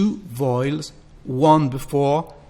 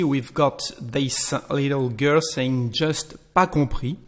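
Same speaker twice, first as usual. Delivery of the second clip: usual speaking voice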